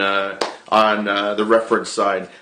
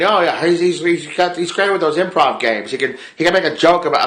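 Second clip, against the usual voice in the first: higher-pitched, uncertain attempt at an accent; Andrew Lincoln; 5 semitones higher than usual. Retelling an actor praising a co-star's improv games, shaky accent attempt. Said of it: "accent wise".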